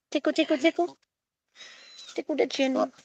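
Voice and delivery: high, soft cooing; small, high playful voice. Real, not acted. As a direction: coo softly while tickling a pet. Tickling the bird while talking.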